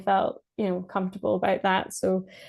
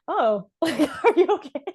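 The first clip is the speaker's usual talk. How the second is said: much higher and louder, laughing through it; laughing concern; 8 semitones higher, 4 dB louder than usual. Retold or imitated. Asking her tearful husband if he's okay, in a high, laughing voice. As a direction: giggle while checking on him.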